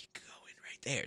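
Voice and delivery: quick, soft whisper; imitated staff whisper. Staff showing concertgoer the entrance, imitated quick whisper. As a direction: whisper a hurried direction like an usher. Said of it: "They're also whispering".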